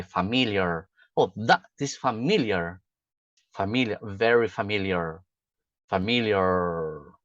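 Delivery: "familiar" as fuh-MIL-yer, American R at the end; English pronunciation. Shown as a demonstration. Teacher giving English examples, familiar with MIL stress and held er. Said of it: "a pronúncia é importante".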